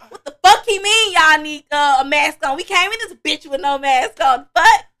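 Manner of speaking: loud, slow, outraged; drunk outrage. Retold or imitated. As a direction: cuss out the guard. Drunk cussing at security.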